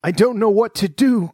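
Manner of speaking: high, giddy exclamation; overwhelmed cry. A narrator's reading. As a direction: cry out, overcome with joy. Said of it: "laughing and crying in the same breath".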